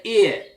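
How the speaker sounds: Cockney "ear" for here, no H; loud, high "ear" with the H left off; dropped H. Shown as a demonstration. Teacher showing Cockney speech, "ear" said with dropped H. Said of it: "They don't pronounce the H normally".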